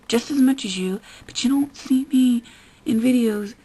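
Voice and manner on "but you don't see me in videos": normal talking voice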